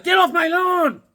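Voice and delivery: high, put-on cranky codger yell; grumpy old man. Old guy shooing neighborhood kids, put-on cranky yell. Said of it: "You're like".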